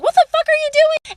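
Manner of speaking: high, loud mock outburst; acted high-pitched shout; retold outraged cry. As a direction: yell it as if mid-scuffle. Acted cry during retold fight.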